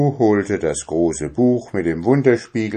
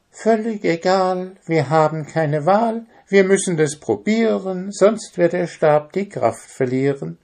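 very high little bird voice; Zwitschi urging; 10 semitones higher than usual. Acted. Zwitschi urges the dwarf to try, high bird voice. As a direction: press on urgently, pitched right up.

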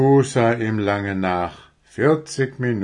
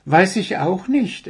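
high, lively deer voice; young deer character; 10 semitones higher, 3 dB louder than usual. Acted. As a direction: play an eager little deer, pitched up.

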